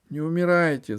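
high, loud tearful plea; crying plea. A narrator's reading. Alice begging the dying empress, tearful. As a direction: beg through tears. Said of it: "заплакала она".

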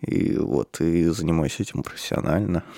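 low, breathy sultry voice; like a phone-sex line. Real, not acted. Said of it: "Что это за томный голос?".

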